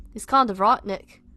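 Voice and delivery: put-on Scouse; bad Liverpudlian accent. Reader redoing Tom's line as British, bad Liverpudlian accent. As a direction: read it in a shaky attempt at Liverpool accent. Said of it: "my shit Liverpudlian accent".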